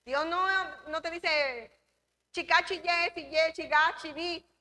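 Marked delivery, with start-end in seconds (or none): 2.3-4.5 put-on quick chi syllables before each word, a playful kids' code; playful chi code, lightly done; speaking in chi. Shown as a demonstration. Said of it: "antes en República Dominicana hablábamos así".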